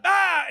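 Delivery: sudden high "Ah!" to startle someone; scare yell. Retold or imitated. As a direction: imitate jumping out with a yelp. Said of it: "in her face".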